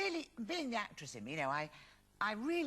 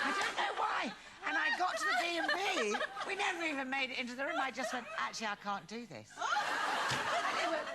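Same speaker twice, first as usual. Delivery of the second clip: high, laughing retelling; giggling at her own story; laughing through it; 6 semitones higher, 4 dB louder than usual. Real, not acted.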